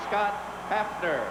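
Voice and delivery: normal talking voice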